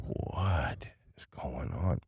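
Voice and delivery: slow, very soft, drawn-out question; barely voiced, stretched-out bewilderment; hushed disbelief. Boy in bed hears dad's creepy words, hushed disbelief.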